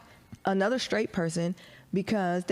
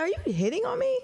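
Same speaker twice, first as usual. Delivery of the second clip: much higher, disbelieving question; incredulous squeak; faster than usual. Retold or imitated. Retold question to the stranger.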